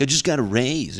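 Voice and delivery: mock boasting. Coworker showing off a new raise, mock boastful. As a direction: announce it like a show-off. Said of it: "another coworker like".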